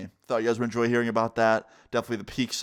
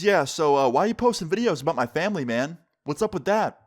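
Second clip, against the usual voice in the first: raised, higher-pitched confrontational questioning; angry dad; 5 semitones higher, 3 dB louder than usual. Retold or imitated. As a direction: challenge him like an offended father. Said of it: "super angry look on his face".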